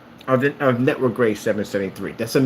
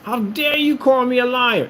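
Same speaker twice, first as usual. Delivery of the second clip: mock indignant; 11 semitones higher than usual. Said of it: "You'll get mad, like".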